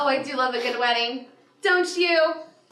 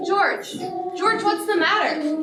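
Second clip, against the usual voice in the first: startled; 6 semitones higher, slower than usual. Acted. Mother startled by son.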